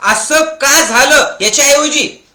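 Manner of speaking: slow, high and loud; loud, slow preaching; emphatic challenge. Acted. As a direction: press the question on them.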